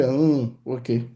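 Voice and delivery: slow, reluctant okay with a hesitant hum; acted grudging consent; displeased agreement. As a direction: give in grudgingly, clearly not pleased. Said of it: "I could hear in his voice that he's unpleased".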